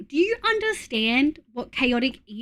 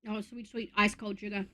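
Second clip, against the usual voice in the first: crunching ice; 3 semitones lower, 12 dB quieter than usual. Real, not acted. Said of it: "So if you hear me crunch".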